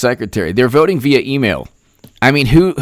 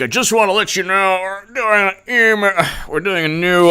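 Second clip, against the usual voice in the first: strained, higher mock voice; hammed-up impression, pitched up; Tom Fitton; 6 semitones higher than usual. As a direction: imitate someone posing as they talk. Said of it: "Tom Fitton's always like".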